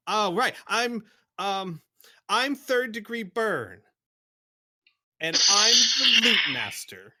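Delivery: high, loud and proud self-introduction; proud and excited. Acted. The small wolfen proudly gives her new fearsome name, high and loud. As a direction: puff up and announce it proudly.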